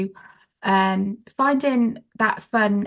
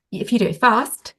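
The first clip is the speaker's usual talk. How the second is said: louder, punchy FAST; energy-building demo; 2 semitones higher, 4 dB louder than usual. Shown as a demonstration. Trainer showing how a game builds energy, louder energised voice. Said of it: "building up that energy".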